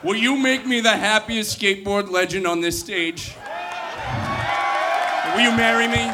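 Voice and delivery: usual speaking voice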